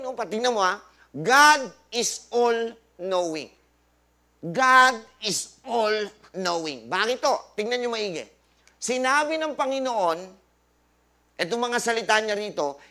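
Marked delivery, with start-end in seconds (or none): 1.1-6.9 slow proclamation. Acted. Preacher proclaims scripture point.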